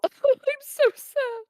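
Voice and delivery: high, loud mock lament; hammed-up little wail of sorrow; mock sadness. Mock lament after calling self evil.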